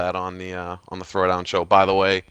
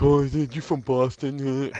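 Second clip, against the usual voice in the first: slow, drawn-out teasing question; lightly imitating a taunting gamer; mocking Xbox gamer; 5 semitones higher, much slower than usual. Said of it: "having to hear people, uh, be like".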